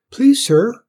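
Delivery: high, polite little servant; small squeaky Cinders. A narrator's reading. Cinders asks Tim about his chores, small high voice. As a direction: pipe up high and deferential.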